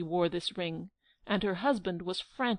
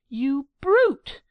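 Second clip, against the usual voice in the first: a loud, higher shriek of brute; screaming; 6 semitones higher, 10 dB louder than usual. Acted. Angelica screaming at Giglio, loud and higher. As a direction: scream the insult at him. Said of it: "Angelica screamed out".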